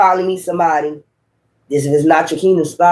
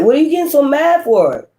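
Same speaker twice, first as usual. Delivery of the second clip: incredulous question; 8 semitones higher than usual. Retold or imitated. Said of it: "voicing the confused woman".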